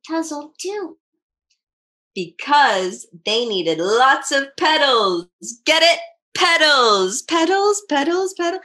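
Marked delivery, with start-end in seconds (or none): 2.1-5.3 slow punchline. Acted. Reading the joke punchline. 6.4-8.6 playful repetition. Real, not acted. Playfully repeating the pun.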